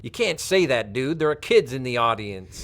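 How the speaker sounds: lightly imitated American accent; a hint of mock-outraged American; American stage director. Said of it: "an American stage director of mine did care".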